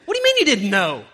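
loud, incredulous retort; acted-out quarrel comeback; mock indignation. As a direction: throw the excuse back loudly.

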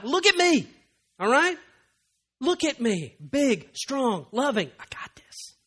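put-on calm them like a frightened child; reassuring God. God reassuring a believer in trial, fading to a whisper. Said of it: "That's what God is saying".

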